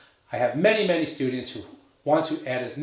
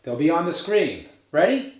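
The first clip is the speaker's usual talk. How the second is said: ordinary talk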